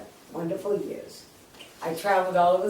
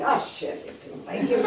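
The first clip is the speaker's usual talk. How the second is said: hushed; 8 semitones higher, 5 dB quieter than usual. Retold or imitated. Buyer realizing who called.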